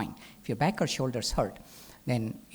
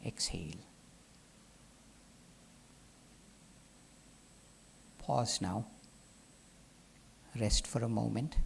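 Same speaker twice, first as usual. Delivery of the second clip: soft, low voice with long restful pauses; guided meditation; 3 semitones lower, 7 dB quieter than usual. Acted. Teacher guiding the class through held breath and rest, guided meditation. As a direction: lead the breath gently and calmly.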